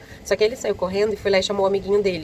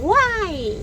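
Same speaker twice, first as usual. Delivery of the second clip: put-on high, bratty "Uai!" like a spoiled kid; spoiled child; 11 semitones higher than usual. Spoiled child mocking the waitress, high bratty yelp. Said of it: "bem criança mimada".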